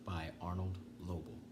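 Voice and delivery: usual speaking voice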